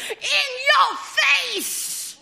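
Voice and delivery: high, drawn-out triumphant shout; crowing yell. Acted. Girl to her father after a good move, crowing yell. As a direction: crow it gleefully, stretching every word high. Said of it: "crows in a very unladylike way and yells".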